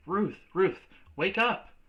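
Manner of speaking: urgent high call to wake her; frightened call. Acted. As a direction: call out in alarm. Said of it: "her mother sound so frightened".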